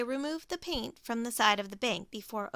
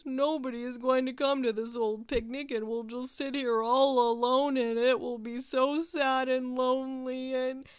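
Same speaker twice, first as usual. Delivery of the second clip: mopey, sniffly lament; self-pitying Walrus; 4 dB louder than usual. Acted. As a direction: wallow in it.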